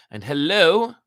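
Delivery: high, loud hello; bright greeting. Real, not acted. The reader greets someone newly arrived, cheery lift. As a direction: pop up with a cheery welcome.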